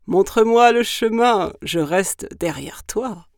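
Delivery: loud, booming monster voice; booming creature, slowing at the end; gruffalo voice. Acted. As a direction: order it like a big beast. Gruffalo speaks, as narrated before.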